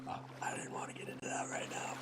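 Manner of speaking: hushed aside under the breath; whispering. Real, not acted. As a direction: drop to a whisper. Avoiding heavy topic, whispered aside.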